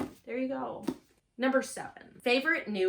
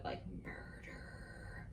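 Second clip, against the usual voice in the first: whispered; 14 dB quieter, much slower than usual. Real, not acted. Savoring the dark theme.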